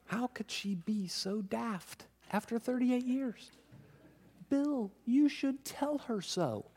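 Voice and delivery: high, goading whisper-voice of the flesh; mocking inner voice. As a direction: needle him like a tempter in his ear.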